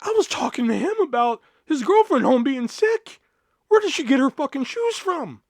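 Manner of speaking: high-pitched, incredulous self-defence; indignant protest. Retold or imitated. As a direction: re-enact protesting a ridiculous accusation. Indignant self-defence to coworker Judy.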